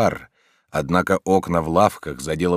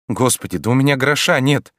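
higher, a touch louder, begging in fright; frightened pleading; 6 semitones higher, 4 dB louder than usual. A narrator's reading. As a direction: plead with her, scared of him. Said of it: "взмолилась пивунья, дрожа от страху". (испуганная мольба)

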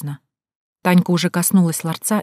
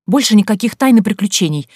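louder, higher firm ban; emphatic, raised-voice telling-off; scolding; 4 semitones higher, 6 dB louder than usual. A narrator's reading. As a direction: lay down the law to your friend. Narrator scolds friend after fright.

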